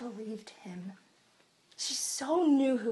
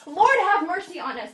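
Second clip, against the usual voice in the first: much louder and higher, laughing; louder plea; 7 semitones higher, 8 dB louder than usual. Shown as a demonstration. Blind men crying out despite the crowd, loud. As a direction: cry it out over the crowd. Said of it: "The crowd told him to be quiet".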